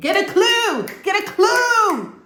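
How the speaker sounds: loud mocking get-a-clue cry; exasperated shout. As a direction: yell it at her like she's hopeless. Mocking reply to therapist line.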